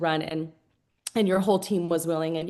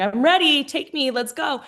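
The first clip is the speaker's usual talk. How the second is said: high, raised-voice mock eagerness; bright-eyed plea, pitched up; eager volunteer; 6 semitones higher, 4 dB louder than usual. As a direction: imitate someone begging to be picked.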